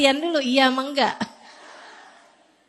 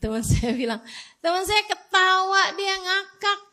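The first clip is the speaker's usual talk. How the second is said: ordinary talk, nothing marked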